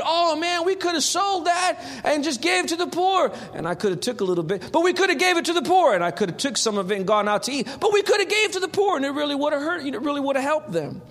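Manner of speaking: mock Judas talking himself into it; scheming Judas. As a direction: rattle it off fast like a schemer who keeps correcting himself. Judas voiced scheming aloud.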